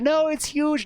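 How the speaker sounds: high mock-frightened squeal; scared girls. Girls insist the spider is huge, high squeal.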